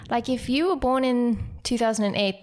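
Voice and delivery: ordinary talk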